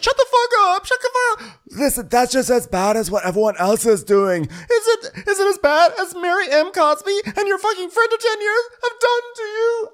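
very high, screeching rant; mock yelling. Host mimics Jen yelling at Lisa, hammed-up shrieking. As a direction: scream it as a furious housewife. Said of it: "what they're yelling about".

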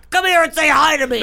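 put-on grumpy old man voice. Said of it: "a grumpy old man just being like".

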